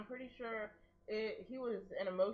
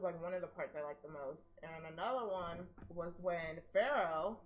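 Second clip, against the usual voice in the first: ordinary talk, nothing marked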